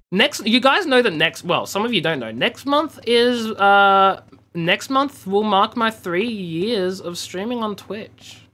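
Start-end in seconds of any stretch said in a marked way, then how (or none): none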